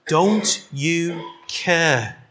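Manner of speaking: slow, drawn-out reproach; frustrated plea. Acted. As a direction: stretch it out as a hurt accusation. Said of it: "She was so frustrated that she even said".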